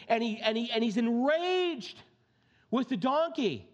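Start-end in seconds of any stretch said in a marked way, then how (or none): none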